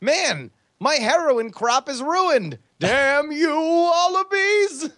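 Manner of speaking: high, building wail of despair, last word drawn out; mock outrage. Farmer finding his heroin crop ruined, mock anguished cry. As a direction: curse the heavens, hammed-up. Acts out farmer cursing the wallabies.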